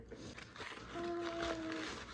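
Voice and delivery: long, high soft gasp; high awestruck gasp; amazed gasp. Real, not acted. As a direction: gasp in delight at what you see. First sight of the package contents.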